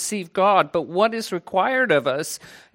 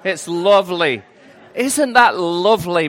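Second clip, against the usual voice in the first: playful, louder mock Yorkshire accent; 2 semitones higher, 7 dB louder than usual. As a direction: put on a broad Yorkshire accent. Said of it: "That was more of a Yorkshire accent".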